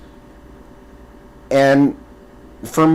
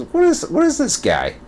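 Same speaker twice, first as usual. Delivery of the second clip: very high, baffled retelling; high-pitched mock bewilderment; mock disbelief. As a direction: squeak out your incredulous reaction. Retold disbelief at the streamer.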